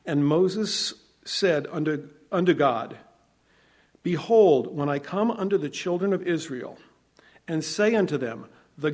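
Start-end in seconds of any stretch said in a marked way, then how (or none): none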